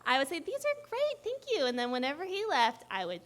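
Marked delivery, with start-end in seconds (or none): 0.4-1.7 high-pitched, put-on thank you; fake delight. Thanking boyfriend for unwanted flowers, falsely delighted. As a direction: gush politely in a much higher voice.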